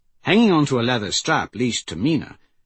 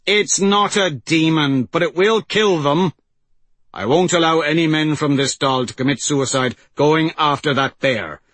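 raised, emphatic insistence; firm refusal; 5 semitones higher, 5 dB louder than usual. Acted. Chieftain refuses to risk his men, firm raised voice. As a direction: put your foot down, louder and higher.

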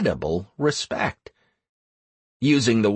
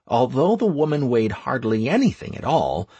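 usual speaking voice

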